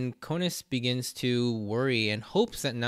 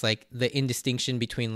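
ordinary talk, nothing marked

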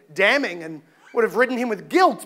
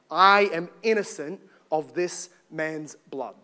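low, deliberate, solemn pronouncement; solemn declaration; 7 semitones lower, 4 dB quieter than usual. Acted. Pilate washing his hands before the crowd, solemn.

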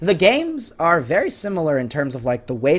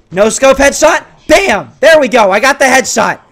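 high, loud excited cheer; triumphant exclaiming; 8 semitones higher, 8 dB louder than usual. Real, not acted. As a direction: crow over the perfect shot. Lands a headshot.

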